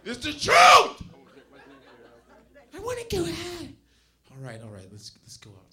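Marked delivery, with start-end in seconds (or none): 0.0-1.0 high, drawn-out yell; comic shout. Acted. Comic yelling at audience. 2.8-3.7 put-on high falsetto girl voice; imitated girlfriend.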